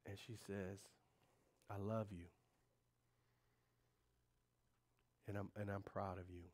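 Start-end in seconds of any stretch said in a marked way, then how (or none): 1.7-6.4 softer, lower tender words; husband quoting his wife's loving words, softer; tender quote. Retold or imitated. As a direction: say it gently, as the wife did. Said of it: "it was weird. That's not her".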